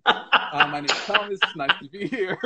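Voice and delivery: giggly imitation of an Australian stage introduction. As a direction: put on Australian while trying not to laugh. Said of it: "let me do an offensive accent".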